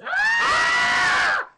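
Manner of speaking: short high loud scream; sudden scream. Real, not acted. Startled scream while playing.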